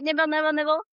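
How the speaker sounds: lively, repetitive little munching rhythm; playful nibbling. Pretend. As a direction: pretend to nibble on each word. Playfully miming nibbling at a phrase.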